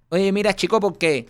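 imitating a forced Cuban accent; overdone Cuban accent. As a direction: parody the actress overusing "chico". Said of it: "decía Chico cada dos minutos".

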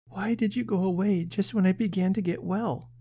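put-on high, pure little girl's voice; innocent child voice. A narrator's reading. Sick child questions Sir John, innocent and sweet. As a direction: ask it high and guileless. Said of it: "asked the innocent voice, so pure and true".

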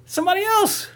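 high, loud worked-up rant; heated rant. Real, not acted. Telling friends the family should finish them, high loud rant. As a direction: rant like you can't believe it. Ranting about the plot.

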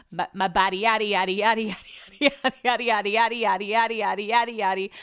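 silly rhythmic rhyme riff on "body"; playful babbling. Pretend. Podcaster goofing on the word body, playful babbling. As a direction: run away with the nonsense rhyme, bouncing evenly.

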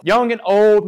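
normal talking voice

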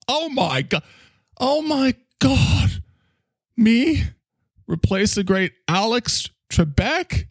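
high, slow gushing astonishment; hammed-up shocked delight; mock surprise. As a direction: gasp it like a flattered star. Acting Ken's reaction.

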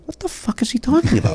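high, puzzled mock disbelief; baffled inner voice. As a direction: imitate your own confused thoughts in the theater. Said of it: "in my head the whole time going".

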